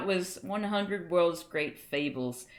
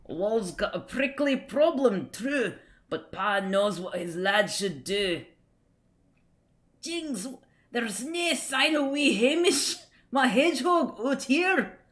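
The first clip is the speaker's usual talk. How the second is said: put-on higher, slow comic-strip Scots accent; 4 semitones higher, 4 dB louder than usual. Streamer reading a Scots comic aloud to viewers, Scots accent. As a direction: read it out in a drawn-out, lilting Scots accent. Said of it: "tempted to read this in Scots".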